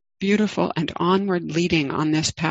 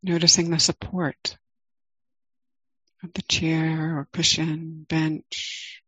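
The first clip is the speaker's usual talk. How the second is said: guided meditation. Acted. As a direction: ease listeners into the body, gently.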